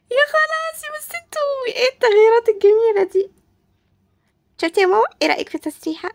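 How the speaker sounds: high-pitched delighted squeal; gushing admiration. Acted. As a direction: coo over the makeover in a high voice. Gushing over a new look.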